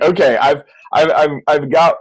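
ordinary delivery

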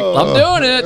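high proud little cheer. Retold or imitated. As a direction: squeak it out with pride.

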